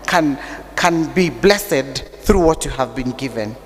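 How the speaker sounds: ordinary delivery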